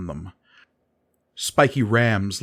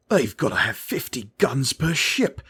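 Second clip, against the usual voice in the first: gasped; 4 semitones higher than usual. A narrator's reading. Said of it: "Davus gasped".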